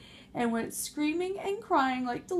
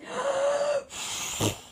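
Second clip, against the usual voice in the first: frightened gasp. Acted. Pigs gasp at last breath.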